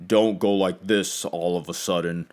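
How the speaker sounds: forced low voice, overcompensating; overdone deep voice. Shown as a demonstration. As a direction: drop suddenly into a put-on deep register. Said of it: "the next day you're talking like this".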